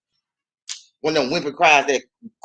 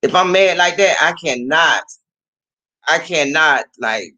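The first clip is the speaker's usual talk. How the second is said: ordinary talk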